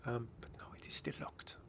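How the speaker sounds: soft disappointed whisper; whispered aside. Real, not acted. Telling viewers the skin is still locked, whispering. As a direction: drop to a secret whisper.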